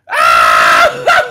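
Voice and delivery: deep laughing shout; laughing yell. Acted. Cracking up at his own taunt, a loud laughing shout. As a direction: let out a loud laughing roar.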